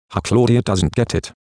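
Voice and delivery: plain reading voice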